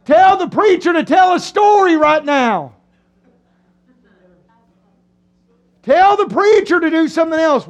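building from time-out call to loud, high demand; mock uneasy protest. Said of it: "you can get kinda like".

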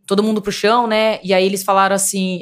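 ordinary talk, nothing marked

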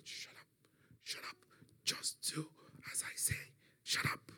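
stern voiceless whisper in short bursts; whispered command. Retold or imitated. Mother silences her frightened son, stern whisper. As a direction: hush him with a firm whisper. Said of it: "heard the whispers".

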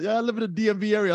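high, fast mock-confident claim; pretending to know. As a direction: fake it like you belong there. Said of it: "I pretended like I knew".

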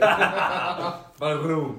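mock Turkish-German accent, laughing; osmanischer Akzent. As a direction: tease with a put-on Ottoman accent. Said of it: "Aber du kannst osmanische Akzente". (aufgesetzter osmanischer Akzent)

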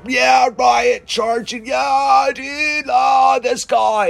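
hammed-up yelling mob fan; mock rioters cheering. Mocking the riot crowd he scorns, in high hammed-up shouts. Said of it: "dude, and go".

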